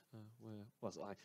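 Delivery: muttered softly to self; quiet aside. Acted. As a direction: drop it under your breath. Host loses track, mutters aside.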